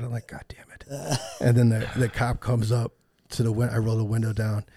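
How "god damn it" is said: hushed, resigned whisper; defeated. Retold or imitated. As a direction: mutter it under your breath, beaten. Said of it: "I'm just, like, defeated".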